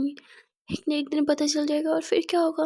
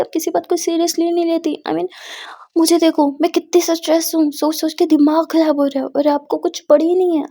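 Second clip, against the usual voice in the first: louder, higher, fed-up venting; stressed outburst; 4 semitones higher, 8 dB louder than usual. Acted. Muskan vents at Aadiya she doesn't care, loud and exasperated. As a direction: let the stress burst out at her.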